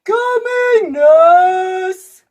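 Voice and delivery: high, loud distant call; calling from afar. A narrator's reading. Doctor calling back from the corridor, loud. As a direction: call it from far away.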